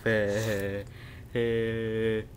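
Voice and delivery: usual speaking voice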